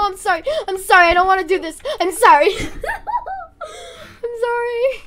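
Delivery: loud, high pleading with laughter; frantic apology. Real, not acted. Player who doesn't wanna do this, frantic laughing apology. Apologising while forced to fight in game.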